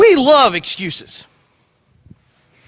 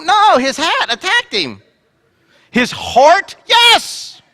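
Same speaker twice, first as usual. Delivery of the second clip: excited yes; 8 semitones higher, much slower than usual. Pretend. Boston heart joke.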